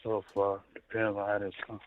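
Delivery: hushed low voice into the phone; whispering on the phone. Real, not acted. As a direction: keep it down, barely audible. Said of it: "Why are you whispering to me?".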